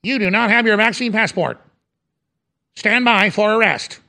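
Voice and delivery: stilted robot command, pitched slightly up; police robot. Acted. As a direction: issue the order word by word like a machine. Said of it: "top of the robot and police come".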